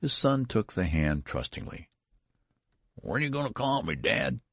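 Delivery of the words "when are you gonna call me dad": put-on higher, quavering old son. A narrator's reading. Old son asks father about his name, quavering. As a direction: let the voice waver upward. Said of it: "he quavered".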